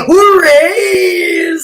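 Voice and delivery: high, loud, long-drawn cheer; Nags. Retold or imitated. Vlogger quoting someone to viewers, hammed-up cheer. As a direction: belt out a mock catchphrase, stretching the end. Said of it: "As Nags would say".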